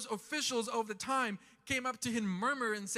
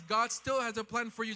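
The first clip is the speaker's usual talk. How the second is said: usual speaking voice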